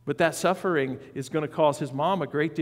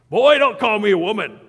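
much higher and louder, an indignant mother scolding; worked-up mom; 7 semitones higher, 13 dB louder than usual. Retold or imitated. Mother scolding her son for calling her woman, indignant shout. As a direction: bark it like an offended mom putting her boy in his place. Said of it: "she gets all worked up".

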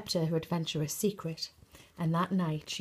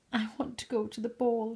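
weeping; 4 semitones higher, faster than usual. Acted. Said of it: "she wept".

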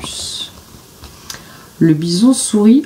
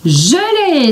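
higher, triumphant cry; excited exclaim; 8 semitones higher than usual. A narrator's reading. Billy catching the bison, exclaiming in triumph. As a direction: burst out with victory. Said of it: "s'exclame Billy".

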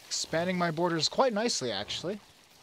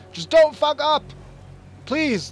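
higher, louder pleading yell; desperate shout; 10 semitones higher, 8 dB louder, slower than usual. Real, not acted. A worried player begging his soldiers as night falls, shouting. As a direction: yell at your troops not to blow it.

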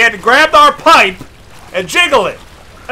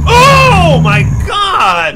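high, drawn-out exclamation; slow wail of shock; startled cry; 5 semitones higher, much slower than usual. Real, not acted. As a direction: cry out at a scare. Jump scare.

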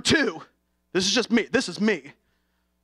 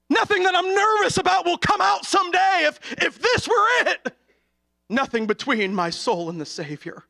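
preaching at full force; 9 semitones higher than usual. Acted. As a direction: urge them on, rising to a cry.

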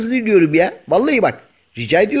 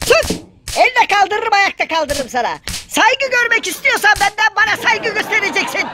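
much higher, sharp indignant voice; furious high retort. Acted. Cat snapping back at its rival, high and indignant. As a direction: snap back in a high squeaky voice.

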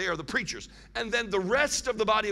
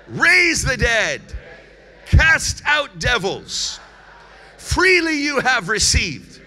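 loud, high, drawn-out command; shouted command; 5 semitones higher, 9 dB louder, slower than usual. Real, not acted. Preacher has congregation command their neighbours, loud shouted command. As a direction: shout each phrase as an order, slowly and forcefully. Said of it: "say it to them as a command".

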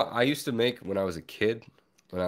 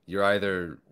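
normal talking voice

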